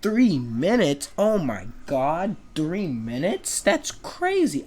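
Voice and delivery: slow, exaggerated mock shock; slow sarcastic kid, squeaking up at the end; sarcastic disbelief. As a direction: drag it out, dripping sarcasm. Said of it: "And then I'm like".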